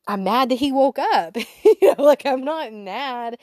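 laughing denial. Retold or imitated. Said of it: "What do you mean, Lord?".

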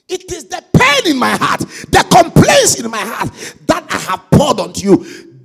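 loud, high preaching cry; full-force preaching. Acted. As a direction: pour it out loudly to the church.

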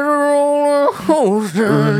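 slow, high drawling cowboy singing. Pretend. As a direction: croon it like a cowboy. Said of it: "It's a cowboy voice".

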